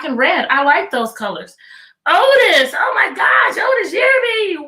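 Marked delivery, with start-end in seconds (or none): 2.1-4.7 high, loud delighted calling out; excited greeting. Real, not acted. Teacher spotting a returning student, excited shout. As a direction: burst out calling his name. Student joins after long absence.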